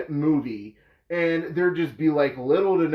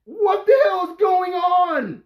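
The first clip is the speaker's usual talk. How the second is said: high, drawn-out cry of disbelief; exasperated, high-pitched mock yell; bewildered shout; 11 semitones higher, 5 dB louder than usual. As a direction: yell it like a totally lost viewer. Acts out viewer lost in dark.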